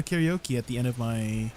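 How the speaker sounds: usual speaking voice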